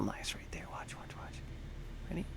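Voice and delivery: hushed whisper while concentrating. Real, not acted. Builder aligning a piece, whispering in concentration. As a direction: whisper as you line it up.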